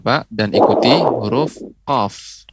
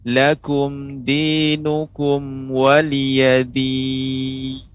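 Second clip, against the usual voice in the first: tilawah Al-Qur'an; 4 semitones higher than usual. Acted. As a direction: recite it in measured, sustained tones. (lantunan tartil Al-Qur'an yang pelan dan mendayu)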